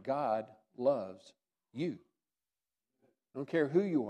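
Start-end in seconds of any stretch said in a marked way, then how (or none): 0.0-2.0 1 slow sincere assurance. Real, not acted. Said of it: "I want to say to you this morning".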